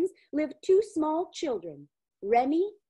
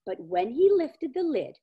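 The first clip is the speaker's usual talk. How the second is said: plain reading voice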